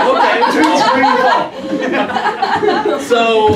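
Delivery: slow words dissolving into high laughter; laughing. Real, not acted. Host cracking up while setting up the show, laughing. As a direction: laugh through it, stretching the words.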